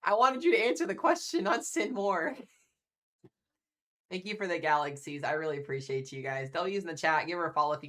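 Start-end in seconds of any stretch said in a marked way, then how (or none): 0.0-2.4 loud, high mock scolding; mock outrage. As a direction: playfully yell at her again. Teasing a donor's oversized gift.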